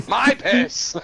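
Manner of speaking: high, loud, triumphant punchline; gleeful mock exclamation. Founder gloating over his idea, mock triumph.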